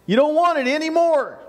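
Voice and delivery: usual speaking voice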